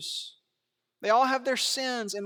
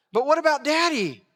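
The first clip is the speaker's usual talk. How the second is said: high, louder worried son; anxious son; 6 semitones higher, 6 dB louder than usual. Pretend. Preacher imagines Jacob fretting to his mother, higher and anxious. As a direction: voice a fretful boy worried about his father. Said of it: "if we do it again".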